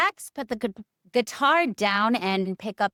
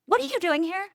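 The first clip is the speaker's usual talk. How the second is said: much higher startled question; surprised mom; 8 semitones higher, faster than usual. Acted. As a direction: catch him red-handed. Mother catches hiding son.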